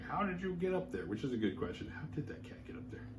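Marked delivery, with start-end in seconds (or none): 0.0-1.1 higher, lightly voiced question to the cat; puzzled owner. Mrs Mack asking her cat how it reached the attic, lightly puzzled. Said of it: "and she's like". 1.9-3.1 whispered aside. Real, not acted. Aside to listeners.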